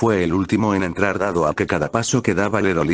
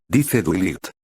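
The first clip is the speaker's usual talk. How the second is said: ordinary delivery, nothing marked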